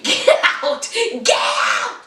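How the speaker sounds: mock yelled dismissal; retold shout. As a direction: imitate a girlfriend ordering everyone out. Retold girlfriend throws everyone out.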